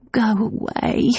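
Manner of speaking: weak moan. A narrator's reading. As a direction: moan it out, too sick to push. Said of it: "Nan moaned".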